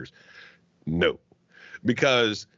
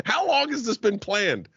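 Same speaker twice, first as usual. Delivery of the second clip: amazed question; 12 semitones higher than usual. Retold or imitated. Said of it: "she was like".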